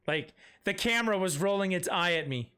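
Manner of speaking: usual speaking voice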